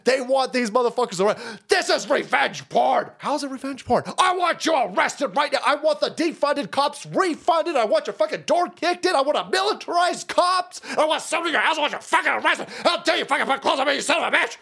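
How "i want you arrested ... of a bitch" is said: high, whiny outraged rant; imitating outraged woman. Host mocking angry women, high and outraged. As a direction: play a shrieking offended woman.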